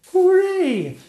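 very high, loud happy princess; joyful cheer. Acted. Princess Sonia gloating at the witch, high and gleeful. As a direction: cheer in triumph. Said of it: "cried Princess Sonia".

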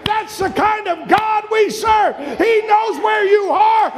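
high, shouted preacher's proclamation; fired-up pulpit delivery; full-force preaching. Real, not acted.

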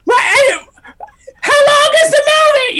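shrieking in a very high voice; hammed-up wail of agony; loud mom impression. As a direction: screech it as a suffering mom. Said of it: "and loudly".

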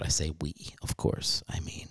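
unvoiced whisper; whispered aside. Real, not acted. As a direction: drop to a secret whisper.

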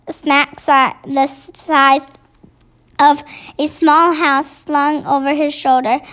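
usual speaking voice

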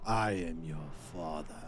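very low, quiet Vader impression; deep, solemn reveal; Darth Vader voice.

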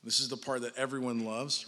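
ordinary delivery

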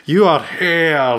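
slow, drawn-out Scottish "here"; mock the uneven Scottish accent from the clip; imitating the actor's Scottish accent. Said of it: "it's very variable".

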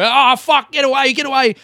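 high, loud mock panic; acted yelp of alarm; scared panic. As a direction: shout it as if fending something off. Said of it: "not be scared or go".

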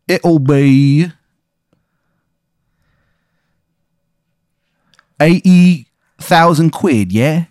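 slow, hammy fake Cockney; bad English accent. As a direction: drawl a bad London accent. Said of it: "it's a really bad English accent".

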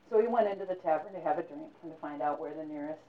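usual speaking voice

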